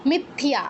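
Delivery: মিথ্যা as mit-thiya, y sounded; careful মিথ্যা with a clear iya ending; full y sound. Shown as a demonstration. Teacher giving an example, মিথ্যা as mitthiya. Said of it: "এরকম".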